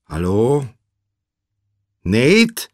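loud, high questioning call; anxious calling. A narrator's reading. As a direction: call the name urgently into a phone. Anxious caller on phone.

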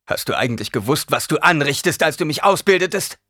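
rising, reproachful demand; bitter accusation. A narrator's reading. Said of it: "hörte die Bitterkeit in dieser Frage".

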